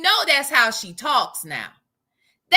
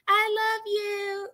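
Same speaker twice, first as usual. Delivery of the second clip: high, slow, drawn-out affection; affectionate; 4 semitones higher, 5 dB quieter, slower than usual. Real, not acted. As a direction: coo it slowly to a friend. Affection to a viewer.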